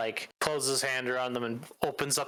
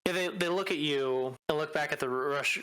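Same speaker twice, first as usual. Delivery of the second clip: usual speaking voice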